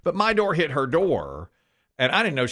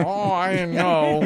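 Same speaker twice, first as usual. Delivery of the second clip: slow, higher, timid nice-guy voice; 4 semitones higher, slower than usual. Shown as a demonstration. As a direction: play the apologetic nerd. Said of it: "a guy who talks like this".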